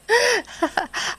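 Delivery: a sudden high laugh breaking out; laughing at having survived it all; burst of laughter. Real, not acted. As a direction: let a big relieved laugh burst out.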